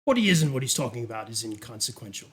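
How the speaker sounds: low, measured briefing with deliberate pauses; sinister duke. Acted. Duke sending Bridget to a presentation, coolly commanding. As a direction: hand down instructions with unhurried authority. Game master plays the Duke.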